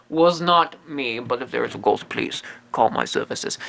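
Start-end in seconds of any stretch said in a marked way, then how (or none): 1.2-3.6 low, soft, sneaky sales whisper; much lower, softer conspiratorial pitch; sly whispered plug. Acted. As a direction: slip the ad in under your breath. Sneaky self-promotion aside.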